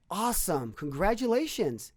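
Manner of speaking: high, slow and bright with delight; cheerful congratulations. Retold or imitated. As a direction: greet the bad news with glad surprise. Boss cheerfully answers resignation news.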